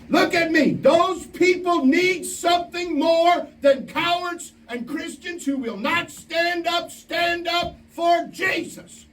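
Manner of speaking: high, slow, ringing pulpit call; full-force preaching. Acted. Preacher rousing campers at camp, slow high pulpit cry. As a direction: drive it home to the whole room.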